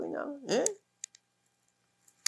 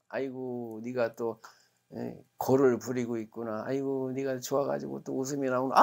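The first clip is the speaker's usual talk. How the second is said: gentle noticing; 8 semitones lower, 6 dB quieter than usual. Retold or imitated. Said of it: "마음을 알아요".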